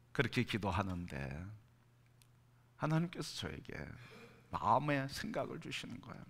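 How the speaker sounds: ordinary talk, nothing marked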